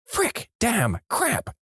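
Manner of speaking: high-pitched muttered swearing, acted out; mock flustered mother swearing; exasperated cursing. A narrator's reading. Mother cursing as boy has accident.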